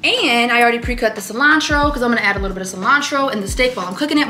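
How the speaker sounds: usual speaking voice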